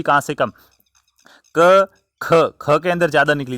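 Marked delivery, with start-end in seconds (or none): 1.5-2.3 क said light and unaspirated, then ख with a strong, louder puff of breath; aspiration demo. Shown as a demonstration. Teacher showing students the puff of air in क and ख. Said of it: "उच्चारण करके देखिए कहाँ से ज़्यादा".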